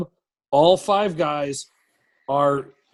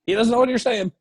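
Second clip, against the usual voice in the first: higher, protective outburst; retold defending a teammate; 8 semitones higher than usual. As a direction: stand up for him, voice pitched up. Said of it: "I was like".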